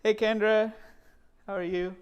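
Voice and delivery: normal talking voice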